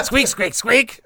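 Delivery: high rubber-toy squeaks; rubbery high squeaky toy. Pretend. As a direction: squeeze out little toy squeaks, rising. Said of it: "Mouse-talica somewhere is like".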